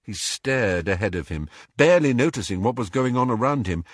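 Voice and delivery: ordinary delivery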